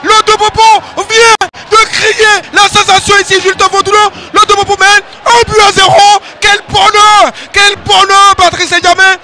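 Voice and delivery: goal call, high and loud; excited sports commentary. Real, not acted. Goal scored, commentator erupts.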